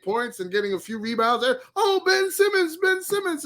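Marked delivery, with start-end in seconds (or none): 1.8-3.4 hyped fans. Retold or imitated. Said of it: "And I'm like".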